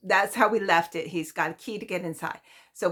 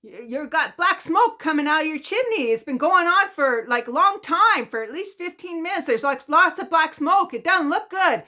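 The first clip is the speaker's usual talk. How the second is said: lightly voiced worried neighbor, higher and louder; neighbor characterization; 9 semitones higher, 8 dB louder than usual. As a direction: play the alarmed neighbor calling out the smoke. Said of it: "I'll try to do a little characterization".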